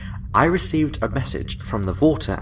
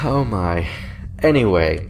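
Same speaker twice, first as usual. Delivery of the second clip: ordinary talk, nothing marked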